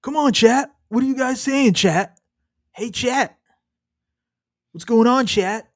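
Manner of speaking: high, put-on livestream host calls; streamer addressing chat. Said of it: "refer to them as chat".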